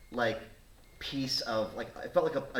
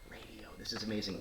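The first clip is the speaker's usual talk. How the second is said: soft, whispery amazement; hushed awe; 7 dB quieter than usual. Retold or imitated. New radio volunteer marvelling to himself, hushed and awed. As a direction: marvel to yourself under your breath.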